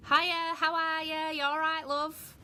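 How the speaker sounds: high, sing-song Northern English greeting; Northern English accent. Shown as a demonstration. Showing how British relatives talk, Northern English accent. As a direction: put on a North of England accent, pitched high. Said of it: "that's my northern accent".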